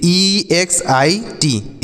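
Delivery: very slow letter-by-letter spelling. Shown as a demonstration. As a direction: spell ईएक्सआईटी one letter at a time. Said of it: "एग्जिट". (अक्षर-अक्षर धीमी वर्तनी)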